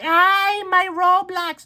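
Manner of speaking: put-on very high, loud childish wail; mock tantrum in a shrieky little-girl voice; whining little sister. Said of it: "your little sister when you take away the app".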